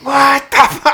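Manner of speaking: high, loud shriek of disbelief; exploding in high-pitched mock outrage; retold mad outburst. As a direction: act out furious shock, cut off mid-word. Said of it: "I was so mad".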